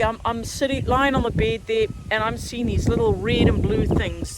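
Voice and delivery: normal talking voice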